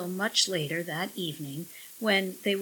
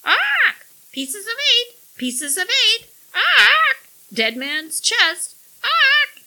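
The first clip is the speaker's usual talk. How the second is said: shrill squawking parrot voice, very high and loud; 12 dB louder, slower than usual. A narrator's reading. Parrot crowing at the couple, loud squawk. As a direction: screech it like a pirate's parrot. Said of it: "the parrot crowed".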